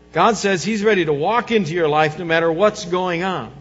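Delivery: ordinary talk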